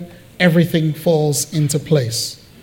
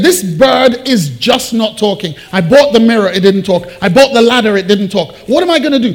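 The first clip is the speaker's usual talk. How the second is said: higher, emphatic grievance; complaining customer; 4 semitones higher, 5 dB louder than usual. Acted. Customer returns to the pet shop, complaining. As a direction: list each failed purchase in exasperation. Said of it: "He was complaining".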